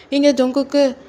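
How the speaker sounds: ordinary delivery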